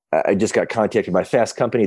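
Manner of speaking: ordinary talk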